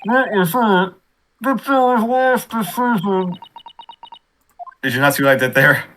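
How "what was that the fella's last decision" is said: muffled behind a hand; hand over mouth. Acted. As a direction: speak through covered mouth. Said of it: "I put my hand over my mouth".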